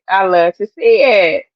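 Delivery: ordinary talk, nothing marked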